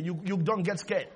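usual speaking voice

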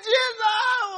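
high wailing cry, drawn out; imitated screaming. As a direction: wail it like the screaming woman. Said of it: "She was screaming".

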